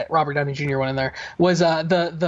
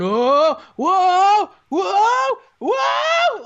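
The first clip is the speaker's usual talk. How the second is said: rising crowd roar, each whoa higher and louder; crowd going wild; 13 semitones higher, 6 dB louder, much slower than usual. Retold or imitated. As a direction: howl like a stunned arena climbing to a shout. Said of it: "the crowd was going".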